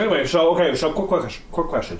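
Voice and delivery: talking while chewing a fry; words around a bite of food; mouth full. Real, not acted. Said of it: "Sorry, I'm eating a fry right now".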